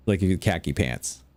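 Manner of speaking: ordinary talk, nothing marked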